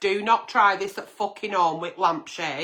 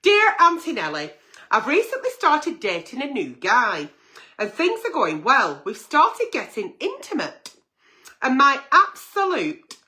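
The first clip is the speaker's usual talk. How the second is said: letter read out higher and louder; 6 semitones higher, 3 dB louder than usual. Acted. Agony aunt reading a viewer's letter, lightly performed. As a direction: read the letter with a hint of drama. Reads listener letter aloud.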